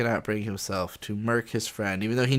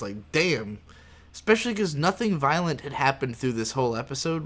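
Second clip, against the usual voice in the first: usual speaking voice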